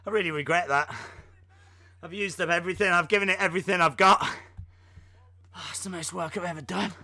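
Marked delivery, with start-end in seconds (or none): 2.9-6.9 breathless after lifting; out of breath. Real, not acted. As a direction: speak winded from exertion. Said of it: "I am so out of breath".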